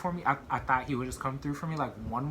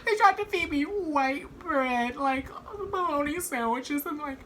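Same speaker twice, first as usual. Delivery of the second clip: whiny jail complaint; 14 semitones higher than usual. Retold or imitated. To mom about jail food, high mock-whiny voice. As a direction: whine it like a hard-done-by kid.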